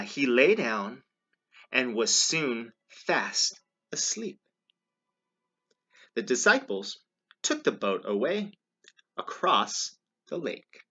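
loud, deliberate storybook narration; read-aloud to children. A narrator's reading. Reader tells children of the nap, storybook narration. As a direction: read it out in measured phrases.

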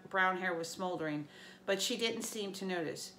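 usual speaking voice

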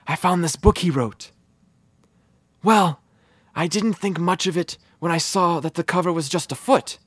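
usual speaking voice

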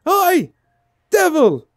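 high, loud cheeky shout; mock shout at devil. Gamer jokes how she would really scold the demon, high, loud mock shout. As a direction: yell at the demon like a rowdy local. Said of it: "It'd be more like".

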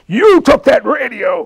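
high, loud, slow accusing yell; big man yelling accusation. Retold or imitated. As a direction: shout it at the kid. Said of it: "he's yelling at me".

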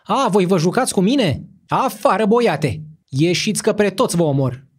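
high, loud furious threats; raging shout. A narrator's reading. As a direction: roar at them to get out. Sick ruler raging at monks.

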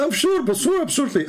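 尖声模仿对方; 高音模仿那人的笃定; mocking caller, very high. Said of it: "He said".

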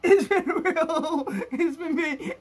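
slow, laughing through the words; laughing sign-off. Real, not acted. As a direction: chuckle the goodbye. Signing off, laughing.

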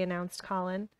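usual speaking voice

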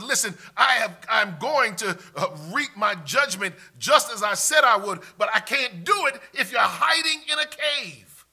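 loud, high, forceful preaching; impassioned pulpit warning in God's voice; God warning Elijah. Acted. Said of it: "God says".

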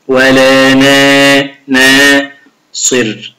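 "ولا نا، ناصر" chanted very slowly, the nun light and thin with a smile; light smiling nun. Shown as a demonstration. Teacher shows the light nun in "ولا ناصر", slow smiling chant. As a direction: smile through "نا" and stretch "ناصر". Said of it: "أبتسم عند قراءة حرف النون".

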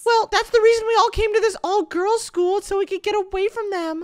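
put-on high falsetto girly voice; man doing a woman's voice. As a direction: squeak it up high like a girl. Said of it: "Get your girl voices ready".

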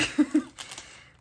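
short breathy burst of laughter; quick unvoiced laugh. Real, not acted. Collector remarks on card quality, short laugh.

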